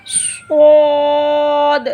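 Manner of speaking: स्वाद drawn out slow and thick, full heavy sound; heavy letter. Shown as a demonstration. Teacher shows students the heavy letter, slow and thick. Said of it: "इसको हम मोटा पढ़ेंगे स्वाद".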